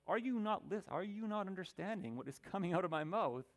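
hammed-up movie-quote exasperation; exasperated explaining. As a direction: act out failing to get through. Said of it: "trying your very best to explain".

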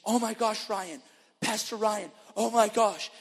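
high loud squeal of excitement; excited lady. Retold or imitated. Healed woman gushing to Pastor Ryan, high excited squeal. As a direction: gush like she can't contain it.

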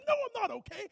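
loud high upset reply. Retold or imitated. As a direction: snap back, worked up. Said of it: "I said".

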